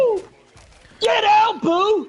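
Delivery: high excited yell at the troll; excited shout. Real, not acted. Cheering his goblin against Boo, excited yell. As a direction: roar it out, thrilled.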